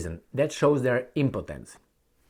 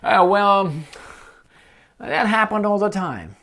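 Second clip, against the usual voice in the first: higher and louder, lightly voiced dismissive official; mock official excuse; 8 semitones higher, 4 dB louder than usual. Mocking the officials' excuse about the drone, higher. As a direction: shrug it off in a put-on voice.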